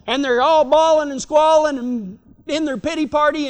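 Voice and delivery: usual speaking voice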